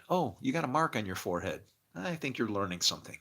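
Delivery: ordinary talk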